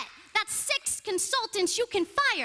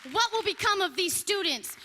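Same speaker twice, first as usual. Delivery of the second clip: usual speaking voice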